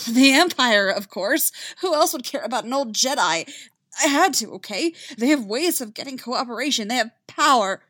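high, loud frightened pleading; terrified and desperate. Acted. Terrified prisoner confesses.

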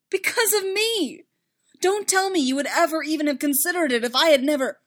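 high, loud, heated protest; distraught, forceful accusation; anguished outburst. Acted. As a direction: shout it, blaming yourself.

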